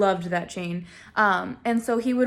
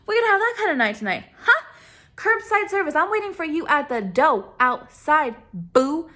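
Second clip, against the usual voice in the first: high, performed challenge with attitude; hammed-up sassy threat; sassy confrontation; 4 semitones higher than usual. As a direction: taunt him, pitched up and playful. Acted confrontation after he hangs up.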